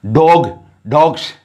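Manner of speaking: डॉग then डॉग्स ending in a z sound; singular then plural. Shown as a demonstration. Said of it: "यहाँ हमने जो शब्दों का उच्चारण किया".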